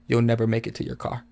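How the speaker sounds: softly spoken, unruffled threat; dead calm. Retold or imitated. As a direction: say the threat quietly, as calm as can be. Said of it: "in the calmest voice ever".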